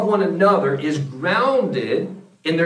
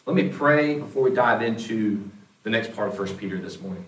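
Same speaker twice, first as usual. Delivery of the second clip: ordinary delivery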